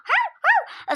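high, loud yapping barks; dog barking. Acted. Child playing the dog Sofiş barks high and loud. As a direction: bark like a small dog.

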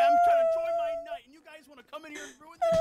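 high, fast, loud outrage; indignant rant. Acted. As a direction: scold them for ruining the night. Berating the attackers.

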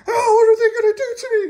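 high, fast squeaky cry of a frightened toy; scared little toy. Pretend. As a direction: squeal it like a tiny toy begging for mercy. Toy voiced in mock panic.

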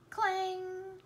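high, loud sung-out clang; high crashing clang sound; voiced clang. A narrator's reading. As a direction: ring it like a cymbal. Cymbal dropped again.

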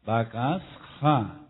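"Bakasja" syllable by syllable, H pronounced as J. Shown as a demonstration. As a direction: stretch out "Bakasja", S then a J sound. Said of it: "porque la H suena como J".

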